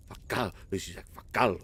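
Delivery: low, soft lightly voiced "Fa caldo"; Italian teacher. Lightly mimicking his Italian teacher, low and quiet. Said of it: "She'd be like".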